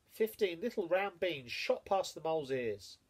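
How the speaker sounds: ordinary delivery, nothing marked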